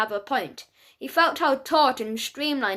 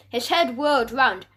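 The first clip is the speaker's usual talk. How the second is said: plain reading voice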